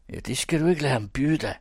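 quick, high, loud egging-on; goading. Acted. Jesper egging Paul on to fight, goading. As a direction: needle them into fighting, fast and high. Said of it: "tirrede Jesper".

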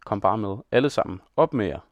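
plain reading voice

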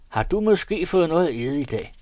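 high, loud challenge; angry shout. A narrator's reading. Valse Peter yells at his companion, shouting. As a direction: shout it at him, pitch right up. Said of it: "råbte Valse Peter".

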